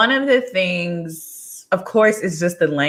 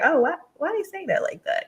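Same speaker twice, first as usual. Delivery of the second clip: high, fast, puzzled question; puzzled surprise; 11 semitones higher, 6 dB quieter, faster than usual. Retold or imitated. To Spanish speakers about their phrasing, high surprised puzzlement. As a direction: replay your own confusion at a strange phrase. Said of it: "but you're like".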